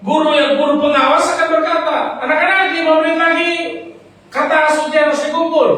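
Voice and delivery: high, loud preacher's cry, pressing the congregation; fiery preaching. Acted. Pastor urging the weary congregation, fiery preaching. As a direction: preach it at full force to the weary.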